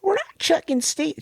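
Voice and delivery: very high, squeaky mock yell; high kid yell. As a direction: shriek it like a panicked kid. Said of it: "She's yelling at her".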